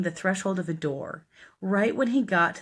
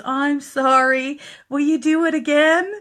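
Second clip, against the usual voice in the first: imitated sheepish apology; 9 semitones higher, 8 dB louder than usual. Billy apologizes and asks again.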